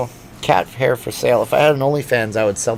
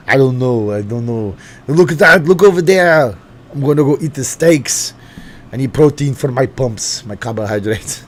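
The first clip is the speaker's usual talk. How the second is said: put-on louder Austrian-accented Arnold voice; Arnold impression; 3 semitones higher, 6 dB louder than usual. A streamer doing a loud Austrian Arnold for chat. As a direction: bellow like a bodybuilder Arnold. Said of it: "Terrible impression".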